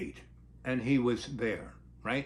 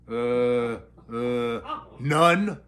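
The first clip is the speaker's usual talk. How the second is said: like Scooby-Doo; 4 semitones lower, much slower than usual. Retold or imitated. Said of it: "he's talking like Scooby-Doo".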